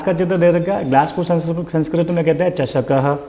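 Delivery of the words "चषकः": चषकः ending in an audible "ha"; sounded visarga. Shown as a demonstration. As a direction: pronounce चषकः as "chashaka-ha". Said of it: "विसर्ग के लिए ह की ध्वनि निकलती है".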